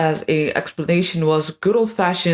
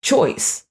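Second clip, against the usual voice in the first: "choice" set apart after a pause, pitched higher; stress on "choice"; 5 semitones higher than usual. Real, not acted. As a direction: land firmly on the single word "choice.". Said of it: "I want to emphasize the word".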